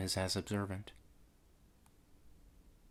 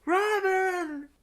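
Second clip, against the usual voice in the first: very high, drawn-out distant cry; faraway call. Acted. Darla calling Robin again, a faraway cry. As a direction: call the name as from afar. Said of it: "called a faraway voice".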